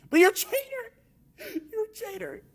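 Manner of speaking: a hammed-up sobbing betrayed host; Shannon Sharpe impression. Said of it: "my terrible Shannon Sharpe impression".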